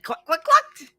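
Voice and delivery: high clucking hen voice; chicken cluck. Acted. Chickens clucking with excitement, high and loud.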